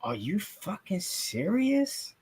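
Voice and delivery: soft, slow, disbelieving repeat; stunned disbelief. Retold or imitated. Reporter repeating his shocked reaction, quiet and slow. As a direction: let the words hang quietly in shock. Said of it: "I was like".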